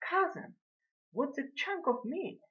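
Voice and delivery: high lady's order at the counter; imperious lady customer. A narrator's reading. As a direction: order the butcher about in a high voice. Acted lady ordering from butcher.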